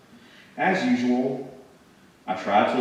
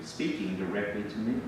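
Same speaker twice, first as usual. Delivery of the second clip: ordinary delivery, nothing marked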